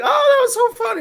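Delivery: put-on very high girly squeal of delight; mock gushing woman. Woman delighted after the kick, mock falsetto gushing. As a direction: imitate her in falsetto.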